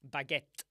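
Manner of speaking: BAGUETTE with a loud, popped final t; forceful "bag-ETT", t fully released; hard final t. Shown as a demonstration. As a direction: punch BAGUETTE out and spit the t. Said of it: "he did a t".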